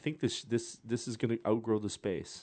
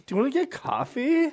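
high-pitched flirty woman asking; coy woman asking for a date; asking someone out; 4 dB louder than usual. Retold or imitated. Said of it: "my impression was the woman".

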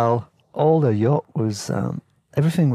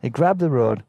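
ordinary talk, nothing marked

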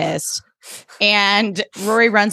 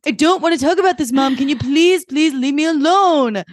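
high, pleading mock-teen outburst; exasperated teen; 8 semitones higher than usual. Teen in acted quarrel with mother.

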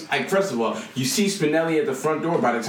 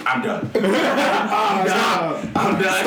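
louder, higher laughing cry of giving up; laughing mock shout; 4 semitones higher, 3 dB louder, much slower than usual. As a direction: yell it through laughter. Retold quitting Overwatch, laughing.

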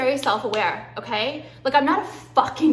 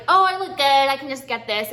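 smug past self; 2 semitones higher, 4 dB louder than usual. Retold or imitated. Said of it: "I was like".

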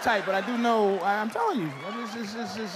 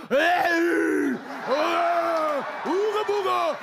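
caveman catcall; 5 semitones higher, much slower than usual. Retold or imitated. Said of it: "I'm not talking about".

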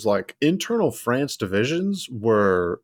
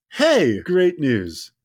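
a higher, bright mock-cheerful greeting; imitating a falsely happy diplomat; 5 semitones higher, much slower than usual. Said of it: "with this happy and cheerful tone".

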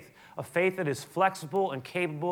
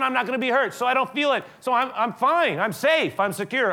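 higher, louder mock self-assured tone; hammed-up voice of someone shielding themselves; mock Stoic voice; 8 semitones higher, 8 dB louder than usual. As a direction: play the smug stoic who feels nothing. Voicing the Stoic mindset.

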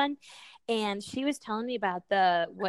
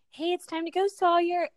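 put-on calling child cheerfully; 8 semitones higher, 4 dB louder than usual. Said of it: "I would just be like".

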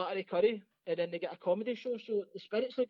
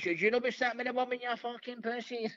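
mock jeering, slowing at the end; mocking heckle; 6 semitones higher than usual. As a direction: imitate a heckler slagging the comic. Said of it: "she was rapping".

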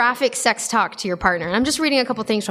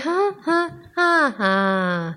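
higher breathy huh huh panting; mock panting moans; 3 semitones higher, much slower than usual. Host demoing awkward moans, mock panting huh. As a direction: pant it out jokingly. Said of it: "You're like".

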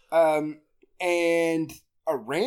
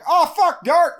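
loud, high mock panic; mock alarm; 10 semitones higher, 8 dB louder, slower than usual. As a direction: cry out in pretend alarm. Acted dude seeing the darkness.

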